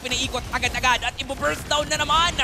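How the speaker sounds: high, loud, driving shoutcast; excited play-by-play. Real, not acted. Caster calls a repeat of game one's play, excited play-by-play. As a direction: keep the voice up through the burst-down. Live call of Lord fight.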